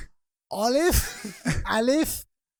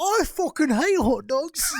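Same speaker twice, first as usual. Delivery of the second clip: John Oliver impression; 7 semitones higher, 3 dB louder, slower than usual. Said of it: "then you have John Oliver".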